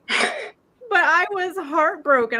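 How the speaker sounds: breaking into real crying over the lost chicken; tearful. Real, not acted. Owner grieving her dead chicken to friends, voice breaking into tears. I bawled my eyes out.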